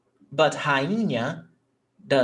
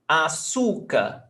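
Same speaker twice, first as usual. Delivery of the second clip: "Açúcar" drawn out slowly on the middle syllable; penultimate stress. Shown as a demonstration. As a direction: say "Açúcar" as a-SUU-car. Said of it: "the stressed syllable is not the last one".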